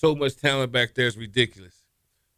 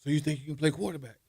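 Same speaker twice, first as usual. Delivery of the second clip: fast, higher mocking challenge; taunting older player; 6 semitones higher, 3 dB quieter, faster than usual. Older quarterback challenging the new recruit, fast mocking taunt. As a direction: size up the new kid with a sneer. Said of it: "and he's like".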